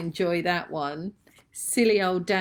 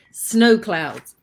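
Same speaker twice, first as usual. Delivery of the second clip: frog in throat; 3 semitones higher, 10 dB louder than usual. Real, not acted. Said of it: "I've got snowflakes in my throat".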